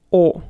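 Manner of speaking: O as a loud, full round vowel; stressed O sound. Shown as a demonstration. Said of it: "we pronounce it like".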